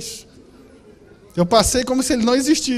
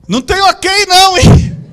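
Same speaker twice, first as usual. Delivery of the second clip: higher, louder call across the house; 9 semitones higher, 12 dB louder than usual. Retold or imitated. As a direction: shout it to the next room. Called out to wife.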